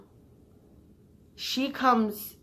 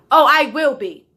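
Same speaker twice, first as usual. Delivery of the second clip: loud, high, defiant comeback; challenging snap, voice raised; defiant retort; 3 semitones higher, 9 dB louder than usual. Retold or imitated. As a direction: throw it right back at her. Defiant reply to boss.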